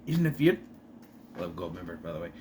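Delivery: ordinary talk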